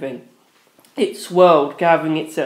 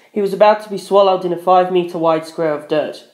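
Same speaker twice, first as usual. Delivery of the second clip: ordinary delivery, nothing marked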